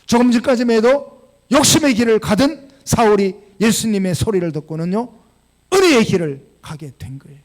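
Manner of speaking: full-force pulpit preaching; fervent preaching. Acted. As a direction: build to the climax with force.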